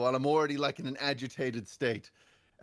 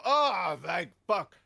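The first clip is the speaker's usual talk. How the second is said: raised, drawn-out "oh" of retold relief; relieved; 2 semitones higher, much slower than usual. Paranoid moviegoer finally reaching the car, relieved. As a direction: let relief burst out, then settle. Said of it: "and finally I go".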